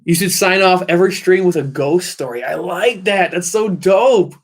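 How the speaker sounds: light up at the idea, stretching the last words; real enthusiasm. Real, not acted. Streamer loving a ghost-story sign-off idea, enthusiastic. Delighted by a viewer's suggestion.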